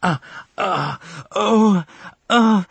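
high, feline wail of pain; loud, raw wailing; wailing cry. A narrator's reading. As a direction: series of rising, drawn-out moans. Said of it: "raw, feline wailing".

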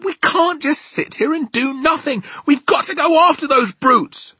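high, loud, frantic pleading; Sophie, urgent. Acted. Sophie urging the giant to go after the brutes, frantic. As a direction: plead loudly for action at a high pitch.

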